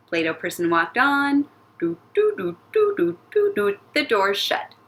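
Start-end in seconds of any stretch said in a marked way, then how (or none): 1.8-3.8 high, bouncy "doo-doo" footsteps; little sung steps in a high voice; tiptoeing doo-doos, pitched high; walking sound effect. Pretend. Voicing the character's walking steps.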